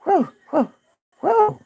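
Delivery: high little hooting yaps from a hiding dog; mock timid dog yelps; yapping from the corner. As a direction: yap nervously from behind someone. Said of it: "yapping around the corner".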